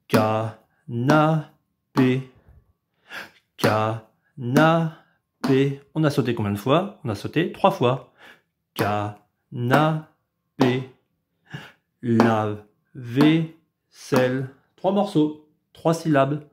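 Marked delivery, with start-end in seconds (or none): 0.1-5.8 canapé very slow, ka-na-pé apart; robot syllables. Shown as a demonstration. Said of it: "vous allez sauter". 8.7-14.5 canapé very slow, ka-na-pé apart; robot syllables. Shown as a demonstration. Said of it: "vous allez sauter".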